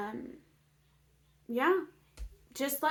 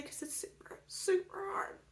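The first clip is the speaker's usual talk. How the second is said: choked, whispered through tears; real crying, almost no voice; tearful whisper; 7 semitones higher, 8 dB quieter than usual. Real, not acted. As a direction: let the voice break into a whisper. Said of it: "kinda makes me emotional".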